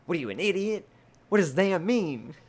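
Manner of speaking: high, loud mock scolding of himself; loud mock self-rebuke, high pitched; self-scolding yell. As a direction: yell at yourself for a silly slip. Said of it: "the first part of me driving was me like".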